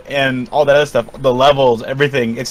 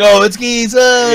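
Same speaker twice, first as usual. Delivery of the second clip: raised-pitch mock crowd reaction; 6 semitones higher, slower than usual. Retelling everyone seeing the door slide open, mildly excited imitation. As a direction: lightly imitate excited onlookers.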